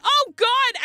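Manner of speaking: loud, high-pitched mock panic; alarmed outburst. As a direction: yelp it out as if re-living the shock. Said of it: "my finger went".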